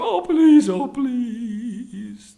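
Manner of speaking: high plea, loud then falling away; desperate pleading. Acted. Brahmin pleading with the tiger not to eat him, desperate. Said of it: "the Brahmin pleaded".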